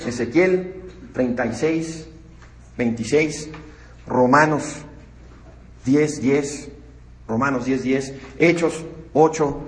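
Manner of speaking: dictating the reference slowly for note-taking; slow, deliberate dictation of a citation. Shown as a demonstration. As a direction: spell out the verse number so they can write it. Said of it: "si quieren apúntenlos".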